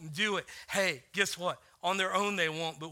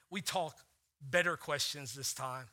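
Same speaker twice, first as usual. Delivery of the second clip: ordinary delivery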